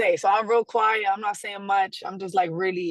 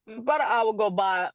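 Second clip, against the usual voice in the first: ordinary talk, nothing marked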